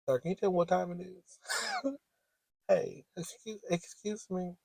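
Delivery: high, soft little sweet voice; mock polite call. Asking the passing man the time, softly mock-polite. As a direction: pretend to get his attention without being heard.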